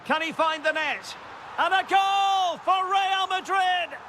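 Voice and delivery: high, sustained commentator's goal cry; thrilled play-by-play at a go-ahead goal; ecstatic goal call. Real, not acted. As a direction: soar up and stretch out the big moment. Calls the go-ahead goal live.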